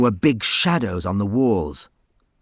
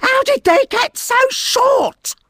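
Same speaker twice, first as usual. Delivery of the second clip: high loud furious yell; 6 dB louder than usual. Acted. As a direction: yell at the whole family. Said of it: "yelled Kerry".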